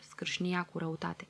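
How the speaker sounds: usual speaking voice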